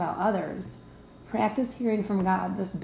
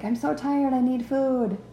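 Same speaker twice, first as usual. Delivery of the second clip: higher-pitched plea of a worn-out body; complaining body; 5 semitones higher than usual. Acted. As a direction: play the body moaning from hunger, hammed-up. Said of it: "Your body's like".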